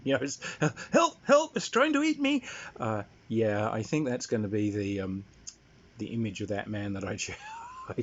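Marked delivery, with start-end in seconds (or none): 0.9-2.4 high, loud imitation of a panicked dad; hammed-up cry for help, laughing at the memory; mock screaming.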